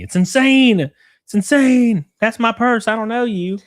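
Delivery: shrill mocking impression. Imitating shrieking woman from clip.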